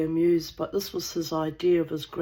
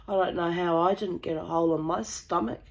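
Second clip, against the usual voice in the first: normal talking voice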